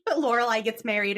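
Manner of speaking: ordinary talk, nothing marked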